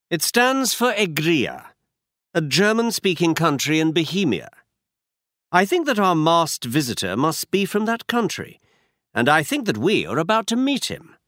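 brisk, sure Holmes explaining a clue; Holmes, lightly voiced.

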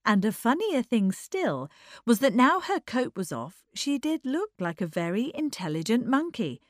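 ordinary delivery

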